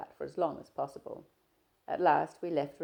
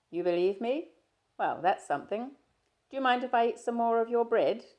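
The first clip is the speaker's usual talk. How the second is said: a louder, lightly voiced young man, breezy; Stephen, teasing; 8 semitones higher, 8 dB louder than usual. Stephen greeting his shy friend, easy and teasing. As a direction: play Stephen chatting easily, a touch louder.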